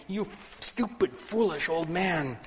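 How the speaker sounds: high, loud furious yelling; angry shout. Acted. Passerby shouting at the old man, furious high yell. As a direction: berate him at the top of your voice. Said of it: "shouts at him".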